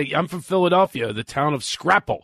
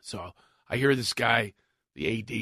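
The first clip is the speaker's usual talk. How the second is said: usual speaking voice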